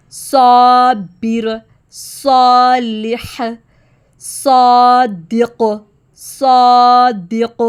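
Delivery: صابر and صادق slow, alif heavy after صاد. Shown as a demonstration. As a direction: recite each slowly with a thick alif. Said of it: "صاد کے ساتھ الف کی sound heavy". (صاد کے ساتھ موٹا الف)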